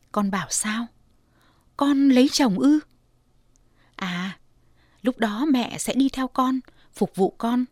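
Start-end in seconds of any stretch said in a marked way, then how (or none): 1.8-2.8 higher, louder surprised question; mother startled by daughter's words. Acted. Mother startled by the idea of marriage, surprised question.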